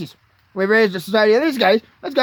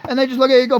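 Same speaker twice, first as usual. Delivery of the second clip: ordinary talk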